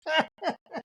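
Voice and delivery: high giggle; high burst of laughter; laughing. Real, not acted. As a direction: let out a high cackle.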